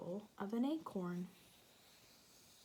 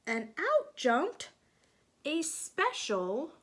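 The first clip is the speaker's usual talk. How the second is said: bright, lilting storybook narration, a bit louder; reading to children; 3 semitones higher, 6 dB louder than usual. A narrator's reading. Teacher reading a picture book to children, lilting storybook voice. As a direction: draw the little ones into the story.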